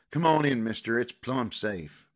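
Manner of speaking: put-on high, loud bitter girl's voice; bitter fury. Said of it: "Her voice held a bitter fury".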